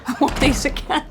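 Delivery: laughing. Real, not acted. Laughing at scene.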